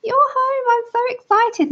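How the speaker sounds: very high, whiny voice for an excited dog; squeaky, hammed-up whimpering words; mock crying dog. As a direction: imitate a pet crying with excitement. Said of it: "starts all his crying like".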